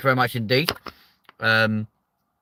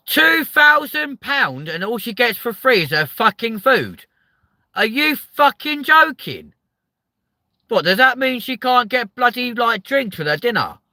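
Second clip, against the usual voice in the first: much higher, loud furious disbelief; outraged rant; 13 semitones higher, 8 dB louder than usual. Real, not acted. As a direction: explode at the price of the cruise. Outraged at cruise drink prices.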